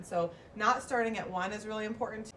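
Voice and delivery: ordinary talk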